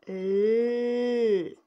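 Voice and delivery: L held long and loud; isolated letter sound. Shown as a demonstration. Teacher showing pupils the first sound of lama, L held long. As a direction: sound out L as one long, steady consonant. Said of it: "La première lettre, c'est le L".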